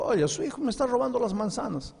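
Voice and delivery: a high, put-on neighbour voice, grumbling; complaining neighbour. Preacher voicing the neighbour complaining to the mother, high and aggrieved. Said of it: "se quejó".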